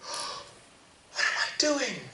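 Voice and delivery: high, slow, gasping cry of despair; gasp, then drag the question out in anguish; a starving boy breaking down. Acted. Boy repeats his question in despair.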